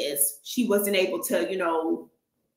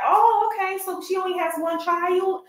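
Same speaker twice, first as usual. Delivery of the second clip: much higher, mock-naive "oh, okay"; naive viewer; 10 semitones higher than usual. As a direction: squeak out the clueless viewer's realisation. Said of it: "they'd be like".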